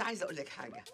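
ordinary talk

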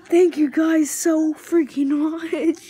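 loud, high burst of gratitude; real gushing fanboy thanks; overwhelmed, near tears. Real, not acted. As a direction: pour out heartfelt thanks, about to cry. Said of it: "I'm literally gonna cry in a minute".

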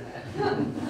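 short breathy laugh; real laugh. Real, not acted. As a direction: laugh at your own joke. After the pirate joke.

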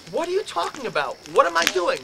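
protest, confused and defensive; exasperated "what am I doing"; baffled protest. Retold or imitated. Baffled retort to roommate.

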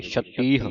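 ਛਤੀਹ with the closing h sounded; audible final h. Shown as a demonstration. Teacher repeats ਛਤੀਹ as the model, final h sounded. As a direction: end ਛਤੀਹ on a breath of h. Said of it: "ਹਾਹੇ ਦੀ ਆਵਾਜ਼ ਉਚਾਰਨ ਹੋਵੇਗੀ".

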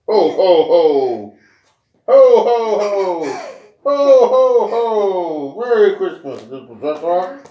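loud ho ho ho Santa laugh, slow; jolly Santa. Acted. Santa voiced for child.

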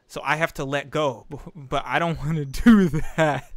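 ordinary talk

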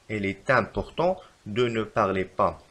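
slow, even reread; slow repeat. Shown as a demonstration. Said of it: "فالخطأ في هذه الجملة".

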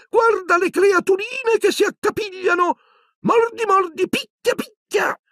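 very high, goading cartoon voice; shrill poltergeist glee. Peeves eggs on the fighting elves, shrill and gleeful. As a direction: egg them on in a shrill squeal. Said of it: "emise una risatina stridula".

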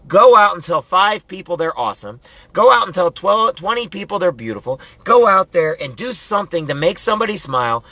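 high, forceful pep talk with punched commands; fired-up motivational preaching. Real, not acted.

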